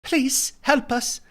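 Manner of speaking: slow, small frightened plea; a hint of timid pleading; mock cowering plea. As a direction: beg like a cowering cameraman. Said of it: "cowering behind the camera".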